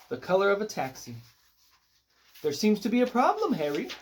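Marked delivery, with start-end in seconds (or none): 2.4-3.9 put-on high, affected English accent; fake English accent. A narrator's reading. Star to her driver, in a fake English accent. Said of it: "she said in a fake English accent".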